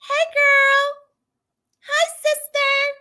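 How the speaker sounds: very high, playful hello; high-pitched greeting. Real, not acted.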